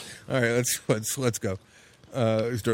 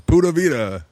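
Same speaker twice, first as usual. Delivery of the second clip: Tony the Italian; 6 semitones higher, 3 dB louder than usual. Real, not acted. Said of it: "like Tony the Italian saying".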